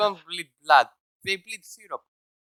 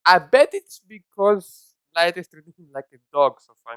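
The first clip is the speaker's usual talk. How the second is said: normal talking voice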